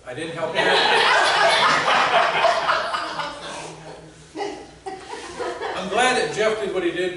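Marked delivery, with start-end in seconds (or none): 0.7-5.7 high, unvoiced burst of laughter; real wheezing laugh; breathless laugh. Real, not acted. Pastor laughing at himself before the congregation, breathy. 5.7-7.2 words through a real laugh, louder; laughing while talking. Real, not acted. Pastor joking to the congregation, still laughing.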